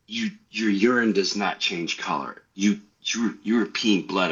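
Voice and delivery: low, halting imitation of a serious wife; grave wife. Wife correcting husband about blood in urine, grave.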